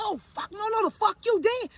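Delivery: high-pitched, indignant protest; mother springing to her son's defence; outraged reaction. Retold or imitated. Acts out outrage at son slapped.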